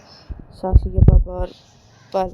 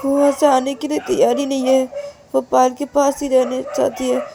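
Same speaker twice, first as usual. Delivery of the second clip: put-on raised, pleading report of a crying child; higher and louder, anxious telling; worried report; 6 semitones higher, 5 dB louder than usual. Worried parents report to Ananya's father.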